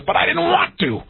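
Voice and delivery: put-on high, sulky childish refusal; a grown man mimicking his own stubbornness; stubborn. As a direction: pout it out like a stubborn kid.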